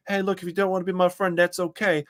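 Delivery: a hint of a laid-back streamer brushing it off; mock calm Nick. Host mocking Nick's public persona, easy breezy calm. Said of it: "cool, calm, collected guy".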